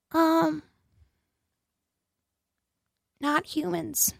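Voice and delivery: high, soft, odd put-on voice; weird co-host voice. Co-host answers the host in a really weird high voice. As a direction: play the strange co-host with a hushed, spacey reply. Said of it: "That's kind of a really weird voice".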